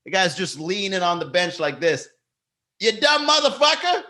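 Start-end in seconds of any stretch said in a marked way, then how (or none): 2.8-4.0 very high mocking voice; imitated smug heckler. Comedian leaning on the bench, mock jeer. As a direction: mimic the heckler's jeer, much higher. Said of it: "He just yells in the back".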